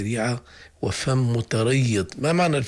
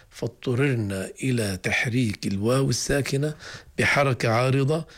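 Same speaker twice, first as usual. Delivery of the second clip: normal talking voice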